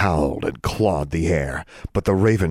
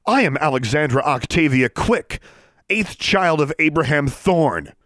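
arrogant declaration; 9 semitones higher, 3 dB louder than usual. Acted. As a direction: announce your lineage to cow her. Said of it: "imitate her father at his most outraged and arrogant".

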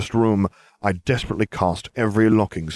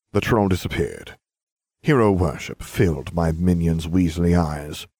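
usual speaking voice

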